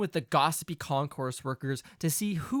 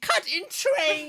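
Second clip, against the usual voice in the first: dramatic mock outrage; 12 semitones higher, 4 dB louder, slower than usual. Dramatic mock echo of phrase.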